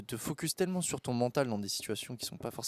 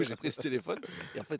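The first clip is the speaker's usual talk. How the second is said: normal talking voice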